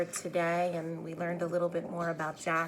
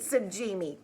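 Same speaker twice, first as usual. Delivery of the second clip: plain reading voice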